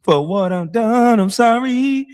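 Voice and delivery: high, slow, whiny begging voice; mock pleading apology. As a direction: plead like a pitiful crybaby. Mocking a tearful apology.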